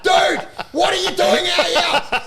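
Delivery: high, loud, drawn-out mock outrage; exasperated shout. Comic confronting pieman mid-show, acted exasperated shout. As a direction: yell in disbelief at the interruption. Retold yelling at intruding pieman.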